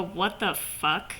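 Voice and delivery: low, soft, slow "What the fuck?"; deadpan low version. Shown as a demonstration. Fan demoing a calmer reaction, low soft slow deadpan. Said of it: "changed their facial expressions to".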